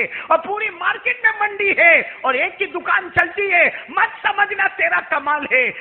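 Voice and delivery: high, sustained, ringing proclamation; impassioned sermon crescendo; full-force preaching. Acted. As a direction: declaim to the whole congregation.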